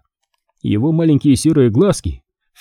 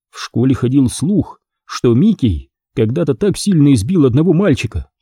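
ordinary delivery

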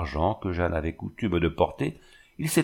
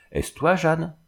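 appel inquiet; 8 semitones higher, slower than usual. Acted. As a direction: question lancée plus haut. Jacques appelle dans la nuit. (appel inquiet dans la nuit)

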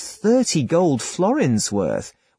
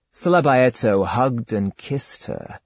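usual speaking voice

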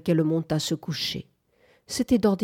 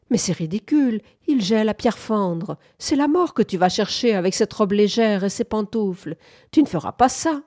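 higher and louder angry protest, rising to a cry; indignant outburst; 4 semitones higher, 5 dB louder than usual. Acted. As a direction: forbid it, heated and shrill-ending. Bunford se dresse pour la bataille. (protestation indignée, plus aiguë et plus forte)

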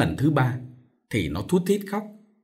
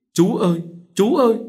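high desperate wail rising louder; crying out; 9 semitones higher, 4 dB louder than usual. A narrator's reading. Girl screaming after the departing soldier, wailing loud. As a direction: call after him, higher, louder. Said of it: "nó gào lên".

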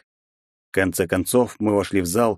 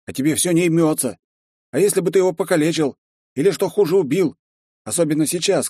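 barely held anger; 8 semitones higher than usual. A narrator's reading. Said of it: "плохо сдерживаемым гневом".

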